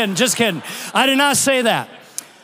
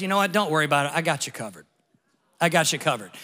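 kind stranger reassuring; 6 semitones lower, 4 dB quieter, much faster than usual. Retold or imitated. Kind stranger offering to pay.

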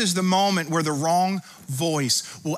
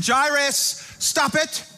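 high, insistent pleading order; urgent command; 9 semitones higher, slower than usual. Acted. Jesus stopping Jairus from believing the bad news, urgent and insistent. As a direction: cut off the fear with urgent commands. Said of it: "Jesus immediately responded and said".

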